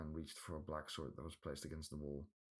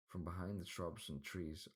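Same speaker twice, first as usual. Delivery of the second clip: plain reading voice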